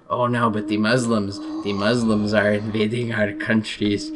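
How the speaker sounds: hammed-up French accent; mock French accent. Frenchman complains to an American about immigrants, mock French accent. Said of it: "I'm doing some joking imitations".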